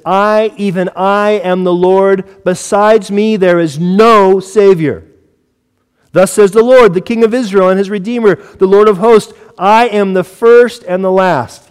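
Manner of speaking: slow, high, ringing declaration; forceful scripture proclamation. Acted. Preacher reading God speaking through Isaiah, forceful proclamation. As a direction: proclaim it with weight, stretching the key words.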